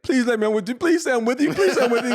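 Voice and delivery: high, pleading whine, overplayed for laughs; hammed-up pleading to a traded friend; mock begging. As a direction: beg your buddy to take you along. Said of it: "I was like".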